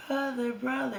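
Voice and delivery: high mock girl voice; mocking girly brother. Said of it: "So they would do".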